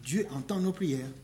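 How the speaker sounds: normal talking voice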